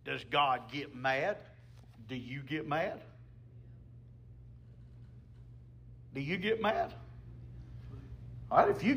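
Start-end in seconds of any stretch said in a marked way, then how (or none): 0.0-6.9 hushed question. Real, not acted. Hushed rhetorical questions to congregation.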